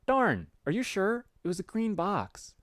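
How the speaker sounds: high put-on voice, disappointed; Garden Gnostic. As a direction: press the friend in a high character voice. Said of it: "put some effort in this voice".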